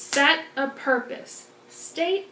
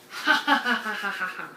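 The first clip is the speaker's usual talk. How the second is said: hammed-up villain cackle; evil scientist laugh; 3 semitones lower, faster than usual. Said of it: "your best evil scientist laugh".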